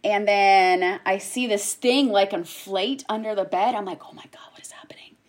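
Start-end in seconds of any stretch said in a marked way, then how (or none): 4.1-5.2 fast, hushed whisper; horrified whisper. Retold or imitated. ER nurse seeing something inflate, panicked whisper.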